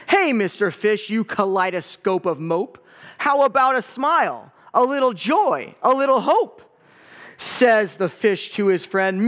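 put-on lively picture-book read-aloud for kids; story time reading. A narrator's reading. Pastor reading a picture book to the congregation, lively read-aloud.

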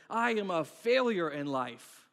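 loud, drawn-out melodrama; hammed-up slow declaration, pounding out the words; really dramatic. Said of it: "I can get really dramatic really quickly".

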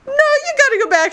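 high loud laughing chorus voice; hammed-up group insisting; mock insisting crowd. Everybody telling the heroine she must go back, high laughing.

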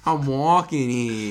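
slow, hammed-up New York "I'm walking here"; mock New York cabbie, drawn out; New Yorker impression. Podcast host hams a New Yorker for her cohost. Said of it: "Is that your New Yorker impression?".

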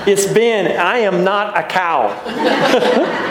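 high, slow toddler imitation; Ben, little child's voice. Toddler correcting the pastor from the back seat, little voice. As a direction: protest like a tiny child, stretching it out. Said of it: "I'll hear this little voice say".

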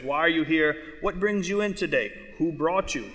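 ordinary talk, nothing marked

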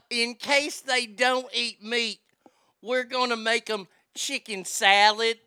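loud, slow mock Southern lady; Southern drawl. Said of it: "you would hear in the South".

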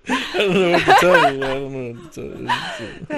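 helpless laughing shrug. Real, not acted. Laughing, mock-helpless reply.

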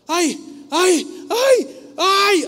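high, loud strained yelps; imitated cries of "ay". Grandfather straightens his arm before everyone, imitated loud high cries. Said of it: "así, así literal como yo estoy haciendo".

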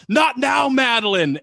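loud high yell at an intruder. Retold or imitated. Boss yells at girl entering office, loud. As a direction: bark it out, loud and sharp. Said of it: "you just hear him yell".

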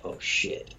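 breathless whispered curse under his breath; panicked whisper. Retold or imitated. Caught hiding in his car, a scared whisper. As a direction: whisper it so the cop outside can't hear.

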